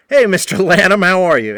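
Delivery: mocking singsong greeting; teasing greeting. Shown as a demonstration. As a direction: tease him with fake respect. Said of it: "kinda like that with a giggle".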